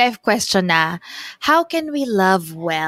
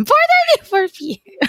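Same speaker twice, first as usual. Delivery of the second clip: much higher, teasing exact time; high mock precise. Shown as a demonstration. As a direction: squeak it out as a joke.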